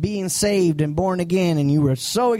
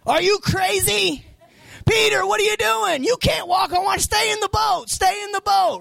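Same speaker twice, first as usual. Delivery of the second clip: much higher, urgent yelling; alarmed shouting; 13 semitones higher than usual. Retold or imitated. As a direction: shout to stop him leaving the boat. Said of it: "reaction of the disciples".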